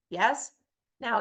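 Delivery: normal talking voice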